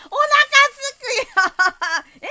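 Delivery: long, slow, loud drawn-out complaint; stretched whine. Real, not acted. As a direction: moan it out, dragging the words. Hungry whine while playing. (長く伸ばして嘆く)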